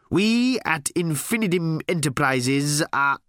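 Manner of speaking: high, slow, grand announcement; corporate proclamation. Acted. New boss announces the company takeover, pompously. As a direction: proclaim the company name with pomp.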